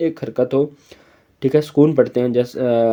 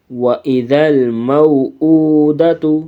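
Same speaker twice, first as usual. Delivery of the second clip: slow, melodic recitation of وَإِذَا الْمَوْءُودَةُ; Quran recitation; 4 dB louder, much slower than usual. Shown as a demonstration. Teacher recites the verse slowly for the pupils. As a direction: intone it slowly like tajweed recitation. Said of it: "recitation demo".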